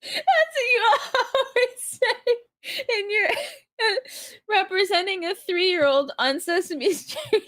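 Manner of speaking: high voice broken by laughter; laughing. Real, not acted. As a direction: talk while cracking up. Laughing at Big Bird bit.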